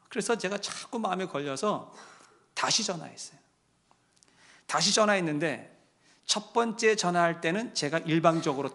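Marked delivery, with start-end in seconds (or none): none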